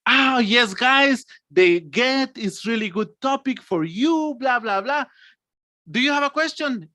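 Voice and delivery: high, enthusiastic teaching voice; hammed-up cheerful teacher; happy teacher. Shown as a demonstration. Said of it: "from happy".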